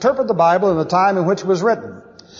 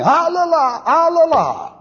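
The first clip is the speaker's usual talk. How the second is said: high wailing ah la la; keen like neighbours mourning at the porch; mourners' wail; 9 semitones higher than usual. Retold or imitated. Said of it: "they all start yelling".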